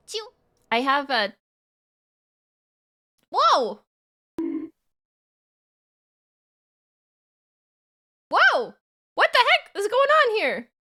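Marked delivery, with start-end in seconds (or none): 3.3-8.7 startled loud whoa; surprised. Real, not acted. Startled by something on stream. 9.1-10.7 high, loud startled question; surprised. Real, not acted. Startled reaction.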